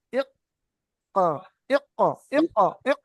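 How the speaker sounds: "ইক" pressed shut, then released separately; caught, bouncing "ইক"; jolted sakin stop. Shown as a demonstration. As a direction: repeat "ইক" with a sharp catch and release. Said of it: "এরকম হয়, বুঝতে পেরেছেন".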